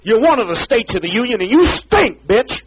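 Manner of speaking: high, loud screaming insult; shrieking rage; furious shout. Real, not acted. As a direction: scream it with contempt.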